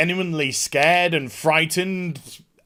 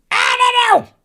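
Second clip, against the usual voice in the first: high, loud, exasperated I don't know; squeaky burst of exasperation; exasperated cry; 5 dB louder than usual. Real, not acted. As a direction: throw it up high in frustration. Exasperated outburst, then plain repeat.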